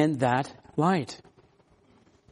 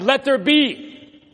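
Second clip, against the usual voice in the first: high, loud and slow proclamation of God; booming divine command; 9 semitones higher, 6 dB louder, slower than usual. Acted. Preacher booms God's words to the congregation, slow and loud. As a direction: call it out like God creating.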